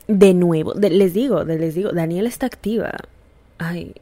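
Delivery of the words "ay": breathy hushed "ay, ¿cómo así?"; soft mock surprise under the breath; whispered aside. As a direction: drop to a whisper, playfully shocked.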